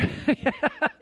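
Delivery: high, loud laugh. Real, not acted. Preacher teasing dry-eyed movie watchers, high laughter. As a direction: burst out laughing at your own joke.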